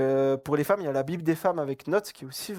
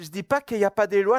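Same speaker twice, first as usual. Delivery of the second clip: ordinary delivery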